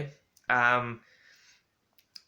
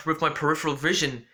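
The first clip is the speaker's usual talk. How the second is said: usual speaking voice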